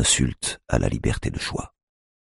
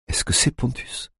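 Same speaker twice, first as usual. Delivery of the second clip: frightened breathless question; 10 semitones higher, 4 dB quieter than usual. A narrator's reading. Mother fearing son's death.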